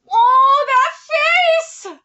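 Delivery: very high, loud squeal; gushing squeal. Real, not acted. Reader reacts to a face in the manga, gushing squeal. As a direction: squeal as if melting over cuteness.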